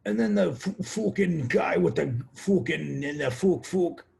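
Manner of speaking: put-on high, loud, garbled Irish accent; mock Irish accent. American imitating hard-to-understand Irish speech, thick mock Irish accent. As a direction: stammer through a thick, hard-to-follow Irish brogue. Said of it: "hard to understand it sometimes".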